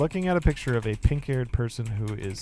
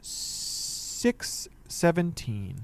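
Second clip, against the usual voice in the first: slow dramatic reveal; 5 semitones higher, much slower than usual. Acted.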